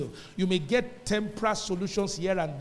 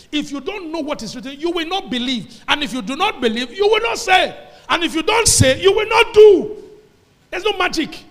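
builds from raised to a high, loud shout; full-force preaching; 8 semitones higher, 11 dB louder than usual. Real, not acted. Preacher rousing the congregation, building to a loud shout. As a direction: drive each line harder until you're shouting.

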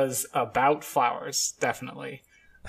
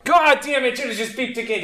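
sudden exasperated curse, pitched up; frustrated outburst; 8 semitones higher, 3 dB louder than usual. Real, not acted. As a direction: blurt it out in real frustration. Smoke alarm beeping again.

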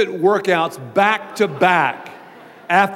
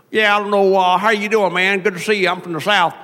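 louder hammed-up Southern twang; exaggerated Southern accent; 3 semitones higher, 3 dB louder than usual. Shown as a demonstration. Pastor showing the wrong idea of Southerners, broad Southern accent. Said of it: "Southern people talk like this".

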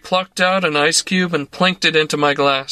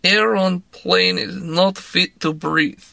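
glum; 3 semitones higher, slower than usual. A narrator's reading. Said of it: "she said glumly".

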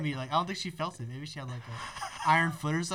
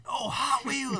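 higher-pitched delight at a new toy; mock excitement; 8 semitones higher, much slower than usual. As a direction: act thrilled by a Happy Meal toy.

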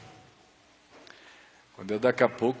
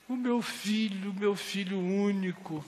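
grieving father; 8 semitones higher, 3 dB quieter than usual. Retold or imitated. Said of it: "o homem chorando".

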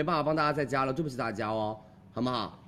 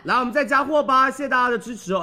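excited; 9 semitones higher, 5 dB louder than usual. Real, not acted. Restocking hype on livestream.

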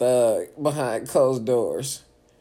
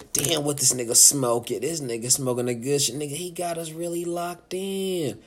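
usual speaking voice

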